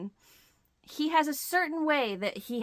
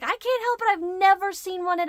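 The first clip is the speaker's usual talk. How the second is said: much higher, louder eager plea; excited protest; 11 semitones higher, 6 dB louder than usual. Retold or imitated. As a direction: gush it out, unable to resist. Defending her purchase to husband.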